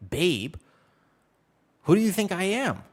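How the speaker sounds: high, loud mock offense; indignant outrage. Streamer mocking being called babe, loud indignant outrage. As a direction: act scandalized at the word babe. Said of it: "I'm like".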